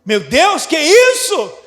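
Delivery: loud, high amazed cry; astonished. Retold or imitated. Believer overwhelmed by a big blessing, loud amazement. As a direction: gasp at a huge surprise. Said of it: "é você cair pra trás assim".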